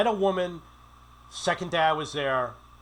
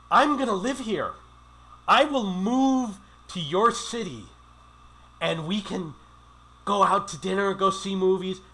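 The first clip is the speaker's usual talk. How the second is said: eager promise; 5 semitones higher, 4 dB louder than usual. Retold or imitated. Man promising a local woman he'll stay, eager.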